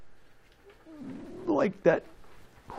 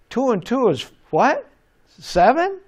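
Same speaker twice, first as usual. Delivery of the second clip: rising squeaky guess, much higher; a kid guessing wrong in class; kid's unsure guess; 8 semitones higher, 4 dB louder than usual. Retold or imitated. Teacher story, kid guessing with a high rising question. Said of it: "the kid says".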